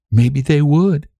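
ordinary delivery, nothing marked

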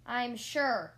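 loud, emphatic assurance; mild squeak, mostly volume; squeaked insistence. A narrator's reading. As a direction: insist on it forcefully. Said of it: "I squeaked".

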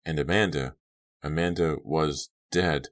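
ordinary delivery

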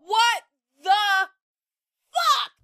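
high startled curse; shocked. Real, not acted. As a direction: jolt up in disbelief. Startled outburst.

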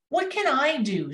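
high, lightly voiced distressed rabbit; a touch of sobbing on a high pitch. A narrator's reading. Frightened rabbit faces the forest fire, mild storybook sob. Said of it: "sobbed the rabbit".

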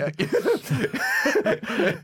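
high delighted laugh; burst of laughter. Real, not acted. Laughs at lover joke.

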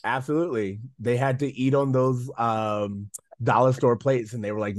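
ordinary delivery

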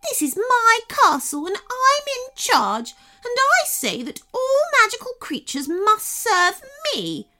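very high, loud imperious princess; shouted tantrum. A narrator's reading. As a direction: assert total command, stretching the words. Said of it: "shouted the princess suddenly".

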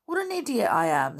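put-on boy cross at himself, high and loud; Dick. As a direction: kick yourself for missing it.

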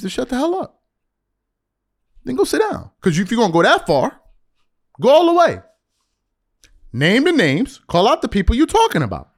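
high, forceful dare; fed-up challenge. Acted. Host voicing Perkins telling LeBron to name names, fed up.

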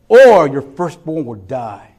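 slow, loud, grave threat; bold warning. Retold or imitated. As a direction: lay down the threat slowly. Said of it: "Stand with boldness and say".

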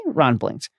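ordinary talk

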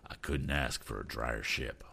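a hint of a humble old sea captain; modest captain. Captain Weston speaking.

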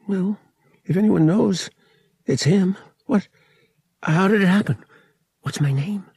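high, urgent questioning; urgent excitement. A narrator's reading. Boy desperate to learn his surname, urgent and excited.

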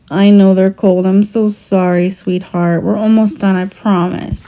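put-on gentle soothing mommy voice to a toddler. Mother soothing hurt toddler.

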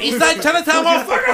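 high, very loud gang-call parody; mock accented shout. Replaying the drunk gang call at a stranger, hammed-up shout. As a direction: yell it way up high in a put-on accent. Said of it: "motherfucker with that accent".

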